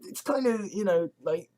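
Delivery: ordinary talk, nothing marked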